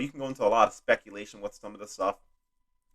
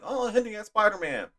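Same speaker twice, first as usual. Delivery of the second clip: mocking fans; 11 semitones higher, 3 dB quieter than usual. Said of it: "Everyone's like".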